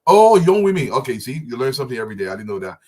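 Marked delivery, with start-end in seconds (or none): 0.1-0.9 surprised realization. Real, not acted. Learns what YW means.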